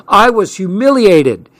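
high-pitched, bitter complaint. A narrator's reading. Mom rows with dad over money, bitter. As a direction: let the hurt pride sting. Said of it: "she said bitterly".

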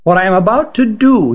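lightly voiced severe expert. A narrator's reading. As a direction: warn with understated gravity. Voices Lydor warning.

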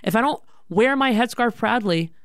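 high-pitched, impassioned retold protest; acted-out defiant teenage daughter; adamant. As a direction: argue it high, firing off rhetorical questions. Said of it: "I was very adamant".

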